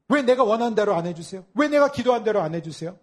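loud, high whining protest; aggrieved outcry. Retold or imitated. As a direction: cry out at God as if wronged. Mock complaint to God.

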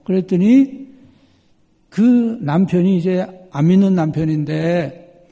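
ordinary delivery

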